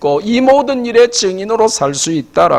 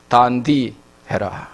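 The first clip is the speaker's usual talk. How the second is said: low, slow Gyeongsang delivery; Gyeongsang dialect; 6 semitones lower, 5 dB quieter, much slower than usual. Shown as a demonstration. Preacher gives congregation the local phrase, low Gyeongsang dialect. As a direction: state it simply in Gyeongsang dialect, pitched down. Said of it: "우리 경상도 말로는".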